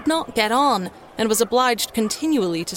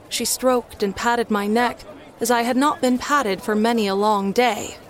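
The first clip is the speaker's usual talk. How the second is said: usual speaking voice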